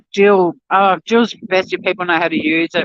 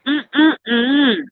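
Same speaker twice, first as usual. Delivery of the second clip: yummy hum; 6 semitones higher than usual. Real, not acted. Tasting avocado toast on camera.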